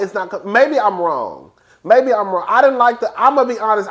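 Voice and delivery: ordinary talk, nothing marked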